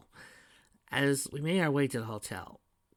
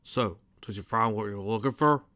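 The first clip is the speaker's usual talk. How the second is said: plain reading voice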